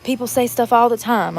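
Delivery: ordinary talk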